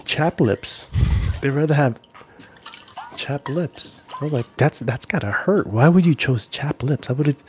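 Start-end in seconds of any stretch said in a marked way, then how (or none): none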